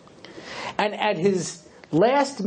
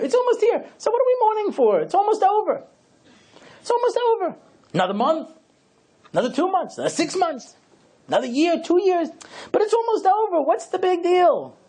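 mock questioner; 12 semitones higher than usual. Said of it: "A person says".

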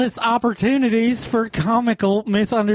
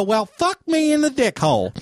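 mock surprise; 8 semitones higher than usual. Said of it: "if I was surprised by something".

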